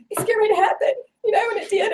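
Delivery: crying. Real, not acted. Tearful retelling of the moment.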